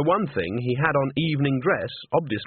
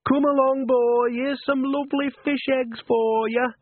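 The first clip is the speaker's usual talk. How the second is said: coaxing a cat; 13 semitones higher, slower than usual. A narrator's reading. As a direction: coo to the cat under the dresser.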